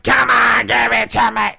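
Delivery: loud, high, full-force shouting impression; shouted wrestler demand; Luna Vachon. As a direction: yell it like a wrestler really getting into it. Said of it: "she would sound like Luna Vachon".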